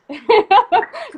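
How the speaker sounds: high, loud burst of laughter; real laugh. Real, not acted. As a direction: laugh out loud at your own boast. Laughs after boasting of no competition.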